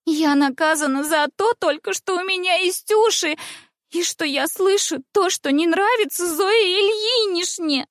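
high, drawn-out capricious whine; whiny drawl. Acted. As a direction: whine it like a spoilt girl faking tears. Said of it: "капризным голосом протянула шалунья Ивина".

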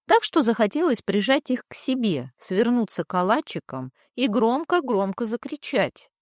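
plain reading voice

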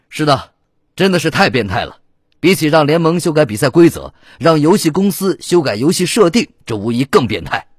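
hammed-up broadcast amazement; reply like an excited match pundit; TV commentator. A narrator's reading.

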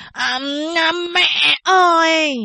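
put-on slow, high drunken howl; drunken bellow. A narrator's reading. Drunk suitor bellowing at the window to the mother, slurred. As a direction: slur it out like a drunk calling. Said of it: "anh ta gào lên".

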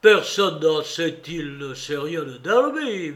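plain reading voice

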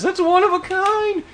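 high squeaky protest; a boy begging for his comic, very high; high-pitched teen protest; whiny kid voice. Retold or imitated. Ethan pleading to teacher.

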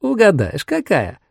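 sly. A narrator's reading. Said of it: "Она так лукаво".

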